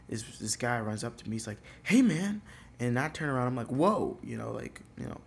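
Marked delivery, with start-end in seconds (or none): 1.8-2.4 high, loud call to a stranger. Retold or imitated. Stranger calling out after the comic, loud. 3.7-4.2 high, loud startled whoa. Retold or imitated. Comic startled by stranger, loud whoa. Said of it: "I shout, like".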